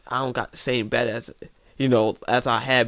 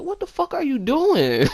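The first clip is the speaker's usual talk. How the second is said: shocked brother; 11 semitones higher, 4 dB louder than usual. Retold or imitated. Angry brother, high and loud.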